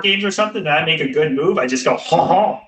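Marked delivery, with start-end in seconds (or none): 2.0-2.6 mock French laugh. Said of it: "talk, like, with a French accent".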